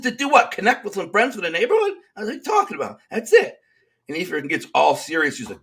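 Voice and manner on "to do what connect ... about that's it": high, mocking disbelief; incredulous. To the deputy warden accusing him of conspiring, incredulous and high. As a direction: scoff at the idea, voice shooting up.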